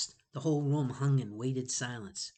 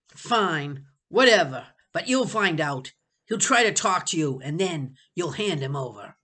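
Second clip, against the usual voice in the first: louder, impatient threat; 3 semitones higher, 8 dB louder than usual. Acted. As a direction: bark the threat. Sebastian threatening.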